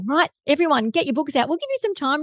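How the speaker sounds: high, playful classroom call; mock teacher voice. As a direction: lightly play a bossy teacher.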